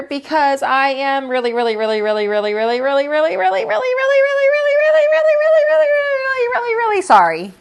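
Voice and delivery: rising from plain to high, loud pleading; building plea. Acted. Lily apologizes to Mister Slinger, building to a high, loud plea.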